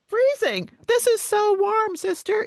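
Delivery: put-on high, squeaky little girl complaining; little girl's voice.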